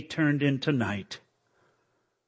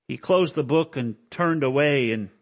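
normal talking voice

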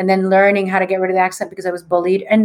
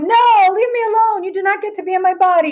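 fierce refusal; 11 semitones higher than usual. Retold or imitated. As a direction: shove the spirit away with your voice. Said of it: "And she went".

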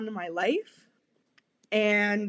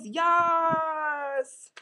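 mock cheer; 3 semitones higher than usual. Mocking zesty men cheering.

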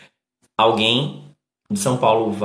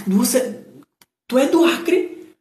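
much higher, amazed student voice; excited classmate; 10 semitones higher, slower than usual. Retold or imitated. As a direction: play the starstruck classmate. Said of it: "aí disse". (colega de sala muito animada, voz bem mais aguda)

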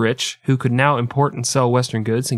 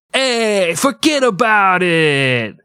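high, slow New York mobster voice; mobster accent; 8 semitones higher, 3 dB louder, much slower than usual. Retold or imitated. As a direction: ham up the mob guy, forget about it. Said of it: "the mob guys are like".

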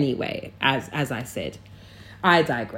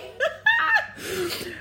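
a sudden, much higher real laugh; high burst of laughter; 6 dB quieter than usual. Real, not acted. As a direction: crack up at yourself mid-rant. Laughing at her own rant.

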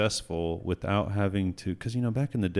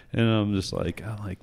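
ordinary talk

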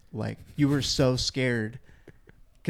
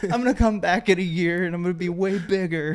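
higher, louder hammed-up boast of a gym bro; mock tough guy; 8 semitones higher, 5 dB louder than usual. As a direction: puff up like a bragging lifter. Coworker boasting he'll bulk up.

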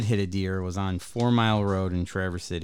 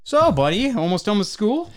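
much higher, louder chummy cop voice; friendly cop; 11 semitones higher, 6 dB louder than usual. Retold or imitated. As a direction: play the cop being chummy with the kid. Cop chats to the kid.

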